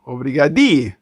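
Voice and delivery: Obrigadi with a playful final i; joking made-up form. Shown as a demonstration. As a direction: mangle it on purpose into Obrigadi. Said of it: "I will actually say obrigadi because I'm an idiot".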